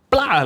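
popping sound. Retold or imitated. Friend imitates the can slamming on the finger, mock pop sound. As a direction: mimic the can snapping shut on the finger.

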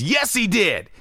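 coworker voice. Retold or imitated. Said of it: "He goes".

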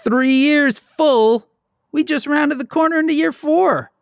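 mock grand anniversary call; sing-song announcer. Opening the show to listeners, high put-on announcer voice.

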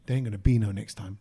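low, soft inner voice, muttered under the breath; thought to himself. Retold or imitated. The line he only thought at the Subway worker, low and soft. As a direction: keep it to yourself, low and quiet. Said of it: "I thought saying".

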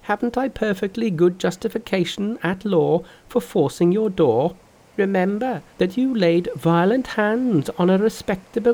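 high, lively trickster voice; high cocky Monkey voice; Monkey's voice. Acted. Monkey argues in story.

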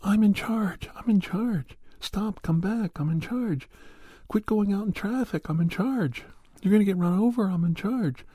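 shrill, very high mock yell; hammed-up bossy woman yelling after a runaway dog. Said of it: "she would yell at the dog".

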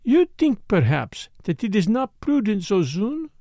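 a hint of French, pouting at him; lightly French-accented Morel. Morel's voice held.

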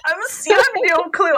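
fast, giddy, laughing through words; can barely contain the joy; laughing with excitement. Real, not acted. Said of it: "I'm real excited".

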